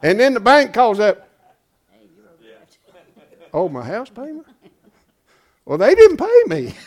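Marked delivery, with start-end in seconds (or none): 3.5-6.7 much higher, laughing mock excuse; mock sheepish excuse. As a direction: play the fool caught by the bank. Mock worker answering the bank.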